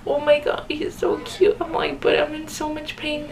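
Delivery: high gushing coo that sags into a pained whine; gushing then pained. Retold or imitated. New mom seeing her baby through the pain, high gushing coo.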